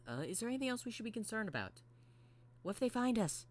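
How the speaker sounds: high, worried character voice; quick, high-voiced robot fretting; concerned Mangle voice. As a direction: pitch it way up and ask anxiously.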